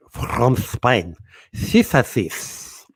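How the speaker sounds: mock lisp, every s turned to th; "Spain" lisped as "Thpain", slow and exaggerated; put-on Spanish lisp. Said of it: "why they spoke like that".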